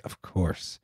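mock-icy, hushed 'of course'; very quiet, withering imitation; icy agreement. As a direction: imitate a partner agreeing with daggers in the look. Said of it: "As she looks at me with daggers".

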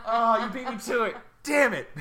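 laughing. Real, not acted. Still laughing.

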